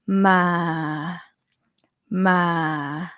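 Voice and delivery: "Ma" said low and level, dropping slightly; Thai low tone. Shown as a demonstration. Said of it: "low tone here".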